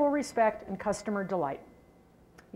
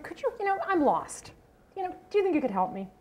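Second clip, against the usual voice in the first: higher, fast, anxious plea; pleading for help; 8 semitones higher than usual. Retold or imitated. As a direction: reenact asking a stranger for help, rattled. Lost driver asks receptionist for help.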